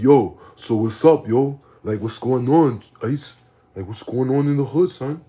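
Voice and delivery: loud fake hip hop swagger. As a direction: put on a tough street voice. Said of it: "the hip hop voice".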